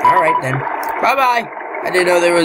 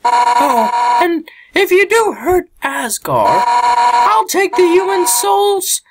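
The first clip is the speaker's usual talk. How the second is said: high-pitched tough fighter voice; high character voice; 12 semitones higher than usual. Acted. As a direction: voice a brash friendly fighter up high.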